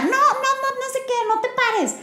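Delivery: very high-pitched scolding cry; mock parental yell. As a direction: act out a parent yelling at a child. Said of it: "Muchas veces queremos gritar".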